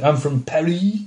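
put-on mock French voice; hammy French accent. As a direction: play a badly faked Parisian French accent. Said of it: "put on a hammy French accent".

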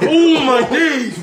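drawn-out, high-pitched disbelief; scandalised cry of amazement; astonished exclamation. Real, not acted. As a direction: stretch it out high and loud, falling away. Reacting to shocking joke.

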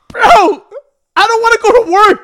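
high, loud mock wail of protest; Cedar. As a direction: imitate a friend yelling his complaint. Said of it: "Cedar's just like".

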